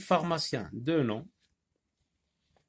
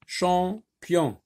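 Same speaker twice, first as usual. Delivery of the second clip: Champion raised, in two parts: cham, pion; syllable by syllable; 6 semitones higher than usual. Shown as a demonstration. Teacher sounding out two syllables, clearly separated. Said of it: "deux syllabes".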